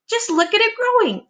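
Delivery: high, thrilled exclamation; Aunt Spiker crying out at the growing peach; excited cry. Acted. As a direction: cry it out in wonder. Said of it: "Aunt Spiker cried".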